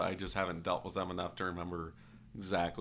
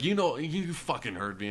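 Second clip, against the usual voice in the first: higher-pitched retold angry snap; mock screaming; 6 semitones higher, 3 dB louder than usual. Hostile customer cursing at the mechanic, mock angry. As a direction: imitate a customer cursing back. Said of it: "starts screaming, uh, obscenities".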